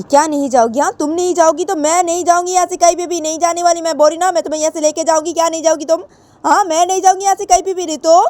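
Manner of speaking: a mother vowing not to leave without her; angry mother. Acted. Mother insists on taking Ritu home, angry high voice. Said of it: "तो तब ऋतु की mummy बोलती कि".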